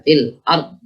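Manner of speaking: "fil ard" with an abruptly stopped ending; wrong short "ard"; cut-off dhad. Shown as a demonstration. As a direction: stop the sound dead at the end of "ard". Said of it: "Tidak langsung syiddah diputus ard".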